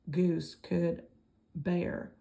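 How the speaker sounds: ordinary delivery, nothing marked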